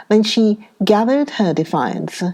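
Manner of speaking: usual speaking voice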